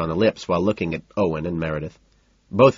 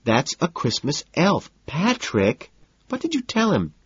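high, petulant complaint; slow, high, wheedling protest; upset whine; 9 semitones higher, slower than usual. A narrator's reading. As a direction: whine accusingly at a partner. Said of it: "Evelyn whines, genuinely upset".

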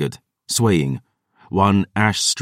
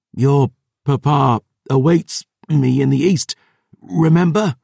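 higher, strained wheeze; wheezing; 6 semitones higher than usual. A narrator's reading. Wounded narrator reminding the terrors of their father, wheezing. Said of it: "I wheezed".

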